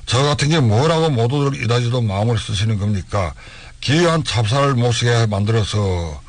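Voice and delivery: ordinary delivery, nothing marked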